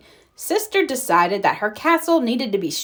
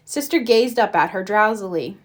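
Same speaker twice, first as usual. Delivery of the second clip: ordinary delivery, nothing marked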